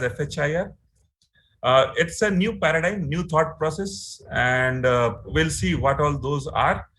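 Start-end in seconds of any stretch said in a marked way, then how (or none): 0.0-0.7 "F H I R" said letter by letter; spelled out. Shown as a demonstration. Teacher spelling the standard's acronym, "F H I R" letter by letter. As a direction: spell out "F H I R", each letter named. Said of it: "it's spelled as".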